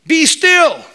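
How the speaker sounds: loud, high command to the waves; commanding rebuke. Acted. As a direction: order the storm to stop with full authority.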